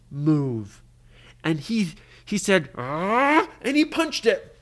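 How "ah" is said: higher, louder "ah" of effort; frustrated yell. Acted. Brady punches the door that won't move, frustrated yell. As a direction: cry out as you throw a punch.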